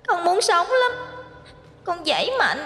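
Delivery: put-on very high, soft ghost-child voice; childlike whimpering tale broken by sobs; eerie crying child. A narrator's reading. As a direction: tell it as a sobbing little monster. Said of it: "vừa đang kể một câu chuyện về nó".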